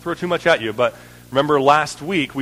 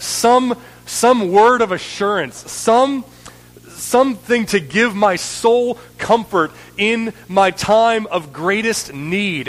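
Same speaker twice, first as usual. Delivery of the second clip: desperate plea; 7 semitones higher, 4 dB louder, slower than usual. Acted. Jesus voiced pleading from the cross.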